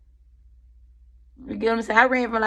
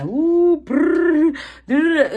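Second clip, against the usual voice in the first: mock ugly cry; 8 semitones higher, 5 dB louder, much slower than usual.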